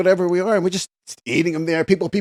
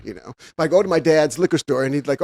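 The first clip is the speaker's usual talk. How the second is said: usual speaking voice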